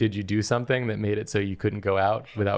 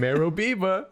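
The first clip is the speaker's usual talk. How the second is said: catchphrase voice; 10 semitones higher than usual. Pretend. Delivers the catchphrase himself.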